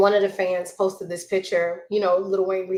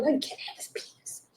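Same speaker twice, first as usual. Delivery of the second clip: hushed aside; whisper; 7 semitones higher, 7 dB quieter than usual. Real, not acted. Whispering the rude bit with a child nearby. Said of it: "I'm whispering because there's a child".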